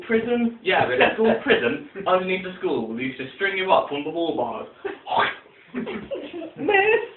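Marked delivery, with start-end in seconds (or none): 5.7-7.0 put-on high, pitiful child's complaint; pleading prisoner kid. Child strung up in school prison begging the teacher, high pitiful plea. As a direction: whimper it up like a kid stuck on the wall for weeks.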